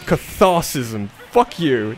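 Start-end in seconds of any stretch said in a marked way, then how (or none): 1.3-1.9 cathartic curse. Real, not acted. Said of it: "That felt really good".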